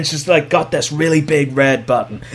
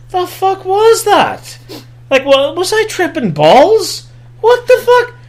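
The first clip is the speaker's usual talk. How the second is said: much higher, stretched disbelief; incredulous viewer; 13 semitones higher than usual. Retold or imitated. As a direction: squeal in total disbelief. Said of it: "you're sitting there going".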